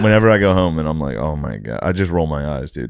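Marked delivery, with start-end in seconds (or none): 1.2-1.8 low, soft, fed up; exasperated. Retold or imitated. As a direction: sigh it with an eye roll. Eye-roll at home.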